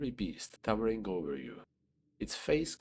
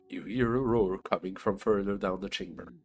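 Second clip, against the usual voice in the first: ordinary talk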